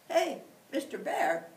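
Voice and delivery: loud high call across the clearing. Acted. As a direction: call out to the bear. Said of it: "he called".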